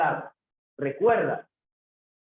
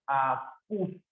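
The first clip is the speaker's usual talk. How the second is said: "Acústico" broken into a, cús, ti-co with pauses; syllable by syllable; 4 semitones lower, 4 dB quieter than usual. Shown as a demonstration. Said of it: "dónde está la sílaba en acústico".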